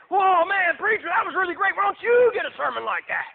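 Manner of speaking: high, loud mock amazement; hammed-up excited praise; gushing congregation. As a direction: imitate churchgoers loudly wowed by a sermon. Whole congregation gushing, needling pastor.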